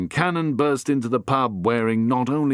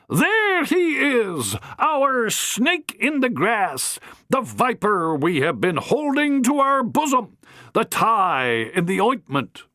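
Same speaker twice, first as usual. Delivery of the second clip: high, slow, theatrical accusation; accusing matron; 11 semitones higher, slower than usual. Acted. Matron denounces Harry, slow and theatrical. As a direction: denounce him to the whole pub.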